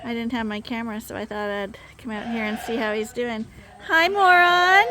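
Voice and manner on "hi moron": high, loud call to the sheep; greeting Moron the sheep, high and loud; excited pet greeting. Real, not acted. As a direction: sing out hello to a favourite animal.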